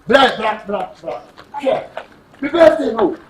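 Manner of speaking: ordinary delivery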